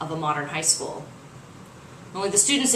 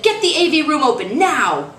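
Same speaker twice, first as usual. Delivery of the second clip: higher, louder command; urgent order; 7 semitones higher, 7 dB louder than usual. Acted. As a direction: bark the order over chaos. Priest ordering over microphone.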